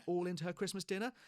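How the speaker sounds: ordinary talk, nothing marked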